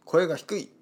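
高めで叱る老先生; 「声が低い」と叱る老先生をまねて; おじいちゃん先生のまね. Retold or imitated. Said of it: "僕の剣道の先生がですね". (生徒を叱る剣道の先生、軽いまね)

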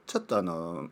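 usual speaking voice

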